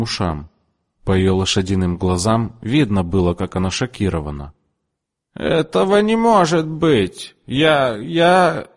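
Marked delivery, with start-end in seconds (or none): 5.3-8.7 high, slow disbelieving stammer; shocked stammer. A narrator's reading. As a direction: lose words from shock. (высокий потрясённый лепет)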